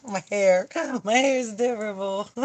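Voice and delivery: amused, giggly exclamation; laughing. Real, not acted. Seeing own hair on stream, laughing. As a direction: say it between bursts of laughter.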